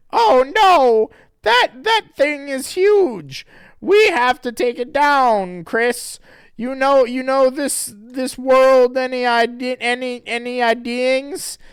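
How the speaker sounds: loud, high hammed-up alarm; high panicky heroine. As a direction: play a cartoon heroine in a panic. Sarah voiced in panic.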